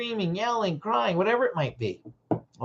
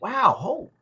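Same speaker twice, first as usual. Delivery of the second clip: curious, gentle; 5 semitones higher than usual. Shown as a demonstration. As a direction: show real curiosity about their frustration. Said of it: "going like".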